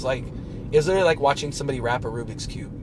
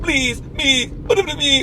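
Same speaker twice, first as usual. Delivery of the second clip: high, loud puppet impression; 13 semitones higher, 7 dB louder, much slower than usual. Fan imitating the rapper's Triumph Dog voice, high puppet voice. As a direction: squawk it out like a puppet. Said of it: "with the puppet voice".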